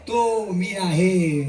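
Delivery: तो मी आहे said very slowly, each word drawn out; तो मी आहे spoken slowly and solemnly; slow realization. Shown as a demonstration. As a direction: let तो मी आहे sink in slowly. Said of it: "repeated mantra, slowed".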